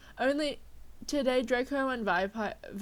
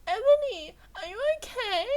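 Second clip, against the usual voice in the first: put-on much higher, louder worried girl voice; concerned friend voice; 12 semitones higher, 6 dB louder than usual. A narrator's reading. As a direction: squeak it out like an anxious best friend. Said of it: "asked me in a concerted voice".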